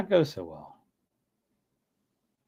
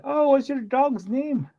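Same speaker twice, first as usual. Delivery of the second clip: high, slow, singsong old-lady imitation; retold older lady, voice pitched way up; 12 semitones higher, slower than usual. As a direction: put on an inquisitive old lady's falsetto. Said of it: "this lady who asked me".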